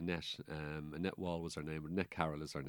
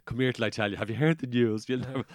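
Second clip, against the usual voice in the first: eager gossip; 4 semitones higher, 9 dB louder than usual. Retold or imitated. Gossips spreading the news.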